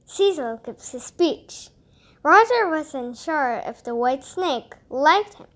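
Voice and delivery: ordinary delivery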